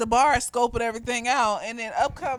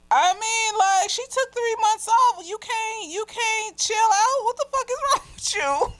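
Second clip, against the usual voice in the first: high-pitched incredulous scolding; exasperated reaction; 12 semitones higher than usual. Retold or imitated. Viewer telling off the film's heroine for overreacting, exasperated.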